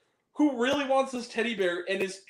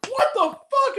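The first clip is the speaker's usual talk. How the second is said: much higher, louder disbelieving cry; a friend blurting out in the theater; shocked outburst; 6 dB louder, slower than usual. Retold or imitated. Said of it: "he goes".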